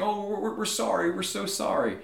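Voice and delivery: high, stammering mock apology; groveling apology. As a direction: plead like cowering subjects, hammed-up. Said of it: "they start saying".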